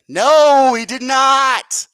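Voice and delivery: loud, high, drawn-out no he did not; emphatic no. Real, not acted. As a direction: punch every word, slowly and loud. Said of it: "answers own question emphatically".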